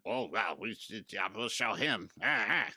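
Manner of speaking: low, soft mumbling imitation; mock grumbling of overweight old politicians; mocking old bureaucrats. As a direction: mutter feebly like ineffectual old officials. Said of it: "congressional people sitting there".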